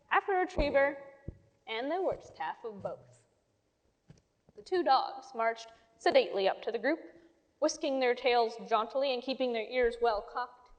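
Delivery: usual speaking voice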